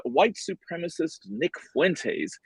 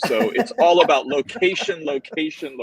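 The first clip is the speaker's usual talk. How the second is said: laughing; 6 semitones higher, 5 dB louder than usual. Real, not acted. Laughing punchline.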